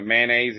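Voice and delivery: mayonnaise run together as "man-ays". Shown as a demonstration. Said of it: "is a redneck word".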